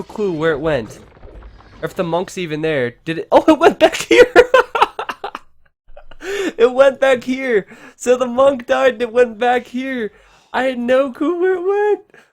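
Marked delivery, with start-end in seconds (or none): 3.3-12.2 high, loud thrilled commentary; excited. Real, not acted. Caster thrilled the relic went back, high loud excitement. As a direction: shout the twist with delight. Relic found, fight erupts.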